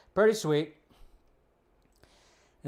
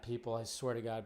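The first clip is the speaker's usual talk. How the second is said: ordinary talk, nothing marked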